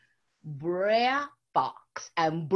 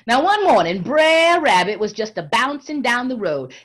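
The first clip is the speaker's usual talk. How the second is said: lively, louder storyteller voice; story narration; 3 semitones higher, 8 dB louder than usual. A narrator's reading. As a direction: tell it big to the audience. Storyteller opening the tale.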